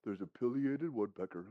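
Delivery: low, soft, unexcited aside; passive. Shown as a demonstration. Birder mentioning a woodpecker, passive and unexcited. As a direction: point it out quietly, with no urgency. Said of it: "I wouldn't be the one who goes".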